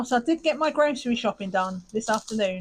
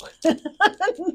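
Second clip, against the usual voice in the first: high burst of laughter; 10 semitones higher, 6 dB louder than usual. Real, not acted. Laughs at own dog joke.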